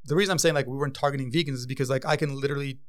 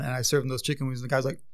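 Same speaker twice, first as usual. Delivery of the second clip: usual speaking voice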